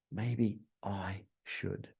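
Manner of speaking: gasp, then low, very slow and soft; musing to oneself. Acted. Customer realising everyone else is doing it, soft slow musing. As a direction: let the idea sink in, hushed and drawn out. Acting out customer's dawning thought.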